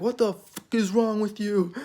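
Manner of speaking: mock pissed off. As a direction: act out an indignant retort, held back in volume. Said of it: "May is pissed".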